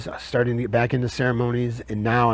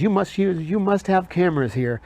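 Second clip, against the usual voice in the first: higher-pitched imitation of a Nigerian woman; mimicked visitor, pitched up; Nigerian lady; 7 semitones higher than usual. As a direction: quote her in a raised, high voice. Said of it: "She's from Nigeria".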